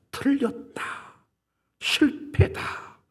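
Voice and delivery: slow, hushed whisper; whispered verdict. Retold or imitated. Preacher voicing God's verdict on Moses, slow whisper. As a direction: breathe the judgment out slowly. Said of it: "이렇게 판정을 내리십니다".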